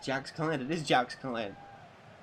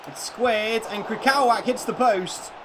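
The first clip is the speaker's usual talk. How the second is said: rising, louder, drawn-out call of a near miss; excited commentary; 7 semitones higher, 7 dB louder, slower than usual. Real, not acted. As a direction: call the shot hitting the post. Streamer commentating FIFA chance.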